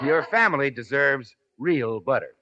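ordinary talk

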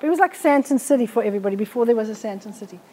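ordinary delivery

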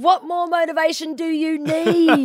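high, loud, drawn-out retort; mock exasperation. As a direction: throw it back in exaggerated disbelief. Retold exasperated retort to co-star.

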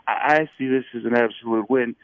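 airy, lightly voiced Ruffalo; Mark Ruffalo. Next step in the chain, airy Mark Ruffalo. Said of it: "it becomes Mark Ruffalo".

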